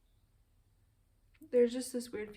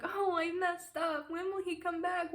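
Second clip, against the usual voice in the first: higher, slow, whiny mock lament; mock pitiful crybaby; 7 semitones higher, 4 dB louder than usual. Mocking how she fears she looks to viewers, higher and slow. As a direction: imitate a moping heartbroken girl, hammed up. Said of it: "sitting around crying all day, being like".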